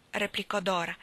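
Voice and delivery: plain reading voice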